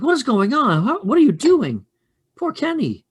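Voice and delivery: very high-pitched scolding voice; fussing, shocked preschool teacher in a high voice; alarmed teacher. Acted. Teacher breaking up a preschool fight, alarmed and high. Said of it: "Comes over and she touches you".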